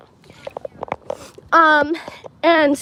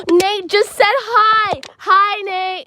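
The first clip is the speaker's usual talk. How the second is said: excited; 5 semitones higher, much slower than usual. Real, not acted. Friend greets her in live chat.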